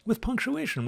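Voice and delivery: emphatic high. Shown as a demonstration. Teacher stressing punctuation to class, high pitched. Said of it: "repeated higher".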